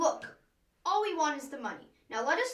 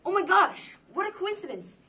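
overexcited; 6 semitones higher, 5 dB louder than usual. Acted. Overexcited about coincidence.

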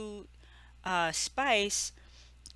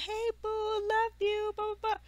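very high, held sing-song greeting; mock sweetness; 14 semitones higher than usual. Star switching up for fans, mock-sweet and high. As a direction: switch to an exaggerated high, sugary voice. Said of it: "switching up".

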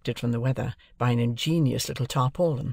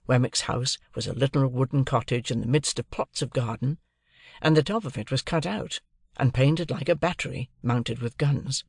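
plain reading voice

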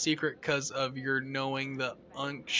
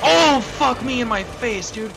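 loud, high, drawn-out cry of shock; startled shout; 8 semitones higher, 11 dB louder than usual. Real, not acted. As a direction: scream it as the monster appears. Jumpscare reaction.

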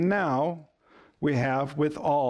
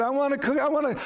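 put-on high, pleading little-kid voice; whiny toddler; 10 semitones higher, much faster than usual. Toddler in the cart begging parent for a cookie, high and whiny. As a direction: beg like a three-year-old in the store. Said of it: "Starts grabbing for it".

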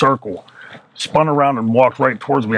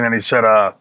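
usual speaking voice

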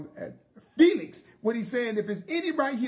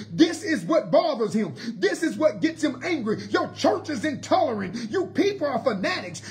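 rising, forceful preacher cadence, louder; preaching at full force; 3 semitones higher, 4 dB louder than usual. Acted. Preacher to the church about the devil, full-force preaching. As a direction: drive it home to the congregation.

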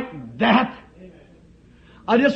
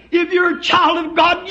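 ordinary delivery

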